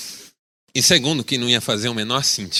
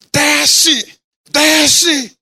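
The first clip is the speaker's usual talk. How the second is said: furious yell; 12 semitones higher, 6 dB louder than usual. Retold or imitated. Angry driver yelling at pastor.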